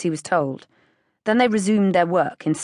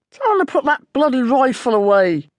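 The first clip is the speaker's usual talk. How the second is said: higher, louder gruff demand; angry; 7 semitones higher, 6 dB louder than usual. A narrator's reading. Farmer ordering the rifle put away, angry loud voice. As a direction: bark the order at the narrator.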